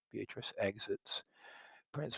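usual speaking voice

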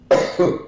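sharp coughing fit after swallowing water; choking on a drink. Real, not acted. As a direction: cough hard as water goes down wrong. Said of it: "No, que me ahogué con el agua".

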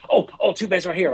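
high, excited discovery; thrilled to find him. Retold or imitated. Excited kid finding episode.

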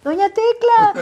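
high-pitched comic catchphrase call; hammed-up calling out. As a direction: call out the name high and theatrical. Acts out comedy catchphrase.

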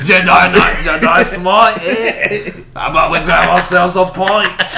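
put-on Scottish accent. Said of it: "Scottish Shrek impression".

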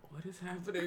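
low, hushed puzzled question; soft retold inner thought; bewildered aside. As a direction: drop it under your breath, baffled. Retold bewildered thought in back seat.

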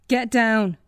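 loud sharp command; shouted. Acted. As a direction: bark the order. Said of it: "the gunman shouted".

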